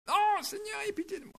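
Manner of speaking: very high, piercing plea; the woman pushes past the apostles, shrill wailing plea; begging cry. Retold or imitated. Woman keeps begging Jesus.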